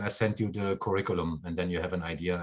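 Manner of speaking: usual speaking voice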